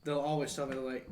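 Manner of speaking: usual speaking voice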